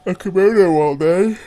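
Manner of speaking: loud, high-pitched gush; excited outburst. Acted. Daydreaming aloud about a job in kimono, excited outburst.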